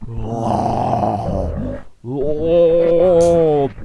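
long drawn-out mock wail. After the slap, a drawn-out mock wail. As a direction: wail it out slowly in jest.

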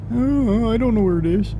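high mock-helpless voice; clueless put-on voice. Plays clueless voice, then answers himself.

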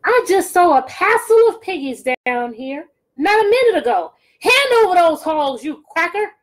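high, loud screeching witch. Acted. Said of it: "screeched into his bill".

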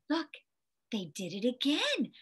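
usual speaking voice

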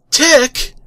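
loud, high sharp call; exasperated shout. Acted. Arthur yelling at the tick over his scraper. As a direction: snap his name out.

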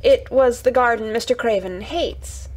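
stumbling, hesitant start; nervous. A narrator's reading. Mary telling Colin of the hated garden, nervous. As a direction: falter over the first word, uneasy. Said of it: "said Mary nervously".

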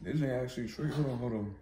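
chewing while talking; speaking between cereal bites; mouth full. Real, not acted. Seen 0: Spooning cereal and eating between bites, mouth working while speaking.

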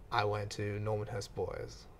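ordinary talk

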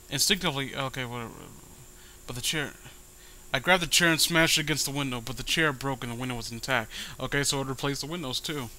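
ordinary talk

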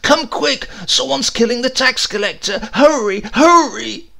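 high, loud frantic shout; a breathless shouted call for help; shouted alarm. A narrator's reading. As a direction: yell for help in a panic.

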